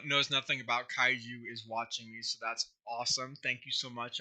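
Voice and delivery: ordinary delivery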